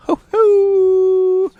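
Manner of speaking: high loud whoop of delight; excited whoop. Real, not acted. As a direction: let out a joyful hoo-hoo. Excited whoop over beer.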